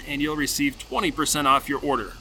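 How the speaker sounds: ordinary talk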